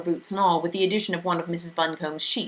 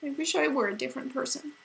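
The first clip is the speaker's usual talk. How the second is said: a touch of shaky, higher and softer; shakily; 8 semitones higher, 5 dB quieter than usual. A narrator's reading. Meg wishing to be someone else, mildly shaky. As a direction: let it wobble a little. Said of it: "Meg said shakily".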